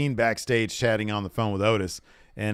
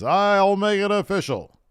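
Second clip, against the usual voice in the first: high, mock-official voice; imitating Adam Pearce; 10 semitones higher, 3 dB louder than usual. Fan voicing the GM making the match official, mock-formal. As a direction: announce it with mock gravity. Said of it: "He says".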